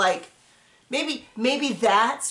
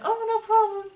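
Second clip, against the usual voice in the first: mock sweet clerk; 11 semitones higher than usual. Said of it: "And the lady's like".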